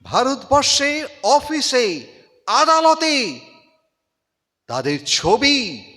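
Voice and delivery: dramatic Bengali recitation, rising to loud peaks and dropping to near silence; impassioned recitation with swelling climaxes and hushed falls; poem recitation. Acted. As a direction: declaim it like a stage tribute to a fallen hero.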